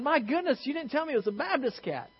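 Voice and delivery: high-pitched surprised preacher; mock astonishment. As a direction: act out a pastor suddenly taken aback. Acted pastor's surprised punch line.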